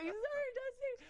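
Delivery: very high, drawn-out little voice; sheepish apology. Retold or imitated. Trainee apologising to her coach, sheepish. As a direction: squeak out a slow mock apology.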